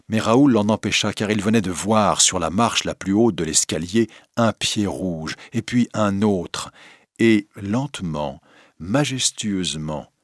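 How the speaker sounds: usual speaking voice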